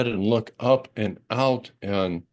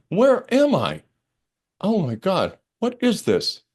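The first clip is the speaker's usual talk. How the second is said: amazed wonder; 7 semitones higher than usual. Retold or imitated. Landing in an unknown place.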